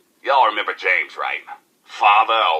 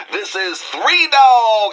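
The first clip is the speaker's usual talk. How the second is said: much higher, louder, drawn-out station ID; hyped DJ sign-off; 12 semitones higher, 4 dB louder, slower than usual. Acted. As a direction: pump up the crowd with your own name. Radio DJ sign-off.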